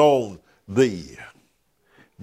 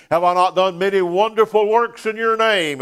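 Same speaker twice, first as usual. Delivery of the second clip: pleading sinners; 7 semitones higher than usual. Acted. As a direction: play the indignant sinner begging. Voicing pleading sinners quoted.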